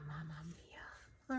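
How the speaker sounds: slow exasperated whisper; sighed whisper. Real, not acted. Annoyed at her sewing, a whispered sigh. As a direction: breathe it out on a sigh. Sighs at a fiddly step.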